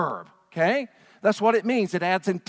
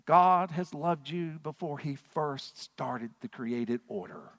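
slow, soft and low; tender and solemn; 5 semitones lower, 6 dB quieter, slower than usual. Real, not acted. Pastor assuring the congregation of God's love, tender. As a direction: let it land gently and slowly. Sermon point, slowed and softened.

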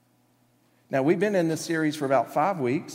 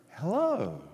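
mock-sweet, higher phone greeting; sweetly; 5 semitones higher than usual. Answering the phone mid-argument with spouse, suddenly sweet. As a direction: switch to a sugary sing-out hello. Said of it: "very sweetly go".

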